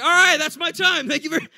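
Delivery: high, loud forced sign-off; re-enacted stage sign-off. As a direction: call out your exit to the crowd.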